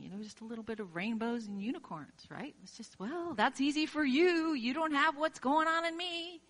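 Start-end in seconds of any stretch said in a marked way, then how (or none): 3.0-6.4 higher, louder, sulky voice; hammed-up complaining listener; mock whiny retort. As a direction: play the grumbling skeptic. Voicing a skeptical objector.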